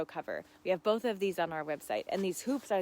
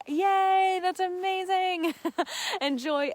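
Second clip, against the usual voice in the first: excited cheer; 12 semitones higher, 6 dB louder, slower than usual. Real, not acted. Cheering a viewer's pickling news.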